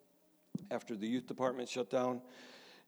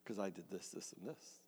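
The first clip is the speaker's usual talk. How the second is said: trailing into a whisper; 5 semitones lower, 12 dB quieter than usual. Real, not acted. Preacher remembering his complaint to God, fading to a whisper. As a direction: drop to a hushed, bitter whisper.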